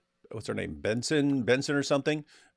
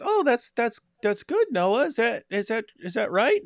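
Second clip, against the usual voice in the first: much higher, mock-sweet mother voice; imitating a mother; 10 semitones higher than usual. Retelling a TV show, mocking the mother Benson's sweet high praise. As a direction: coo it like a gushing TV mom. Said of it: "And she goes".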